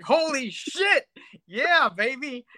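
high-pitched slow whoop of delight; excited cheer. Retold or imitated. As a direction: cheer like a thrilled fan. Excited reaction to movie scene.

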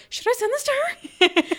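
slow, very high hesitant question. Retold or imitated. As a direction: ask it high and slow, unsure. Self doubt retold.

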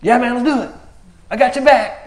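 high, loud and eager, all in; hyped sidekick saying count me in; eager buddy. Retold or imitated. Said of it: "the armor bearer's like".

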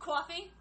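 "Coffee" with a rounded kwaw Jersey vowel. Retold or imitated. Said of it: "You say coffee, too?".

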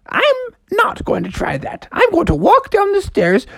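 very high cartoon mom voice; high falsetto, primly declining the stunt; squeaky storybook mother; Froggy's mom.

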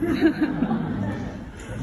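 light airy laugh; breathy laugh. Real, not acted. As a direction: laugh at your own joke. Laughs at own joke.

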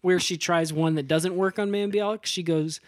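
usual speaking voice

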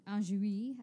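usual speaking voice

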